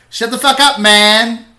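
loud, high-pitched yell; acted outburst at full volume; angry retold shout. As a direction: shout down someone cutting into your act. Retells star snapping at singer.

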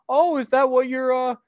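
high, loud mocking sneer; mocking dad voice. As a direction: tease him like a sarcastic father. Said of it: "So he goes like".

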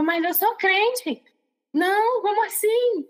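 high, stretched-out disbelief; baffled protest. Retold or imitated. Believer recalls protesting what she saw, baffled. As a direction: protest in incredulous, swooping high pitch.

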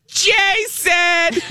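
very high delighted squeal of a name; screechy Marianne. Retold or imitated. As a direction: shriek his name like an overjoyed mom. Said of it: "a really screechy voice".

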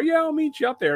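high-pitched mock drunk kid. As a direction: imitate the eager tipsy probie brushing it off.

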